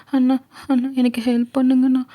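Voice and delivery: high, tearful plea for help; tearful girl. Acted. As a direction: beg him through tears, high. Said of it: "இப்ப எதுக்கு நீ அழுதுட்டு இருக்க?". (அழுதுகொண்டே உதவி கேட்கும் சிறுமி)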